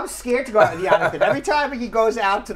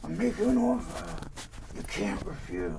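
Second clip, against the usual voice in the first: Godfather impression; 6 dB quieter than usual. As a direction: mumble it like the Godfather. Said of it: "I can't. I'm not good at it".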